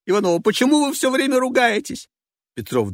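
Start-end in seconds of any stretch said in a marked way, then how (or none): 0.4-2.1 high, loud indignant rebuke; shrill scolding. Acted. Passenger scolding Petrov for swearing, high and loud. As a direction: scold them both sharply.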